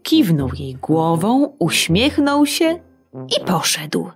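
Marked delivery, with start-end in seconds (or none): none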